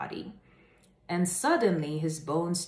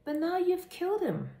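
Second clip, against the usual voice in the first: much higher accusation; accusing animals; 12 semitones higher than usual. Acted. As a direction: cry out in disbelief. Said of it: "said the animals".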